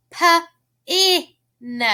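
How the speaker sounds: "P-I-N" as puh, ih, nuh; sounded out. Shown as a demonstration. Teacher helping children hear a vowel, "P-I-N" as puh, ih, nuh. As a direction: break "P-I-N" into separate letter sounds. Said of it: "Do you hear the I sound?".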